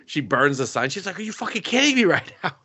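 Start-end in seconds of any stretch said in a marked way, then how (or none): 1.2-2.5 high, fast mock outraged yell; retold yelling. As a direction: yell in disbelief, imitating her, pitch way up. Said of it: "she's all just yelling at him".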